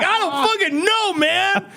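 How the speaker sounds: high-pitched panicked yelp, acted out; mock-terrified customer blurting back; retold panic. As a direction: play frozen fear bursting out high. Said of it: "frozen in fear".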